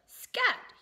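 a sharp, high, loud royal shout. A narrator's reading. King shouting at the mouse to leave, loud. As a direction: bark the word out at the mouse. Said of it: "he shouted".

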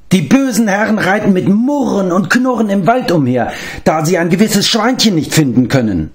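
a pitiful little creature complaining; whining beast-man. Acted. Gurgi complains he gets no thanks, high whining creature voice.

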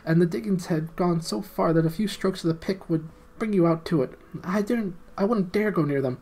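high, eager little gnome pleading. Acted. Gnome Golg explaining to the prince and friends, high and eager. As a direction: squeak it out like a small underground gnome.

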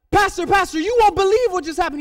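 high, loud breaking news; excited outburst. Retold or imitated. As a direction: act out her bursting to tell the pastor. She phones back with amazing news.

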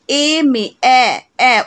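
"এ" repeated on its own, loud, as a pure vowel; vowel sound shown. Shown as a demonstration. Teacher showing students the first sound of "এম এ", vowel sound stressed. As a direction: sound out "এম এ", then isolate the opening "এ" as a vowel. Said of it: "উচ্চারণটা আমাদের ভাওয়েলের মতো হচ্ছে".

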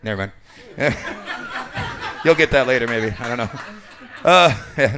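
ordinary delivery

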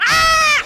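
high startled shriek; scared scream. Real, not acted. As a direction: scream in fright.

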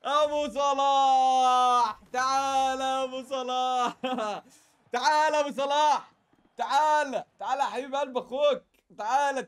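loud drawn-out call to a player; excited calling out. Real, not acted. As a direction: call him in loudly, stretching the name.

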